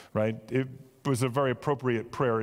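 ordinary delivery

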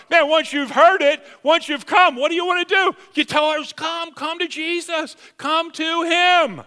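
loud, high, impassioned preaching; fervent preacher urging the crowd to come; full-force preaching. Acted. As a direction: lift it up and call them in. Preaching climax.

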